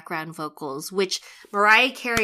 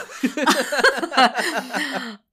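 laughter; 7 semitones higher, 5 dB louder than usual. Real, not acted. Laughs at the echo.

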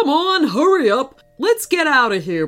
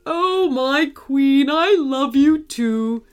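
slow, drawn-out, higher hippo declaration; lovestruck cry; 4 semitones higher, slower than usual. A narrator's reading. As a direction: cry out your love as the smitten hippo. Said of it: "he cried".

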